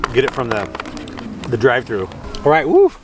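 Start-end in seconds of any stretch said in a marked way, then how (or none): 2.4-2.9 excited cheer. Real, not acted. Cheers while eating.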